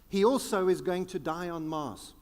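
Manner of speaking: ordinary talk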